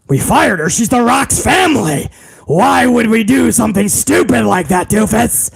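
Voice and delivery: mock-outraged boss impression; Vince McMahon. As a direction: berate the underling in hammed-up disbelief. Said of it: "Vince McMahon was like".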